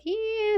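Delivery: high, thin mock screech; imitated screech. Nails scraping the blackboard, a high imitated screech. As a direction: squeal like nails on a board. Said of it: "so screeching".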